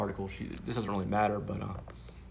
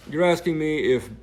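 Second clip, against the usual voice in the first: own voice, mocked; 7 semitones higher, 11 dB louder than usual. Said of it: "doing her voice and my voice".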